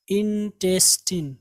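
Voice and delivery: put-on slow इंटेस्टाइन, ending in "teen"; correct pronunciation. Shown as a demonstration. Teacher drills students, slow इंटेस्टाइन with "-teen". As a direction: draw out इंटेस्टाइन as in-tes-TEEN. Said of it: "क्या पढ़ेंगे आप इसको".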